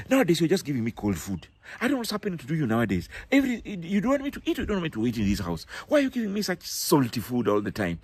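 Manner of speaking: fast, rushed nagging complaint; husband griping at mom over cold salty food; complaining accusation. Acted. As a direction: fire off the grievances quickly, aggrieved. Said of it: "But if you say".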